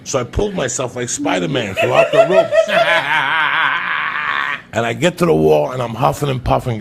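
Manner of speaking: loud laughing while telling. Real, not acted. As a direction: laugh through the story.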